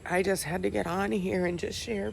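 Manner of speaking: real tears, soft and broken; crying through the words, long pauses; snotty crying. Real, not acted. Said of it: "Sorry for the ugly crying face".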